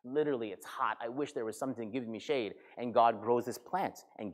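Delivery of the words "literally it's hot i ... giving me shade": quiet, weary grumble; soft, hammed-up moan; mock complaining. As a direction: grumble under the heat, sorry for yourself. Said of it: "he starts continuing to complain".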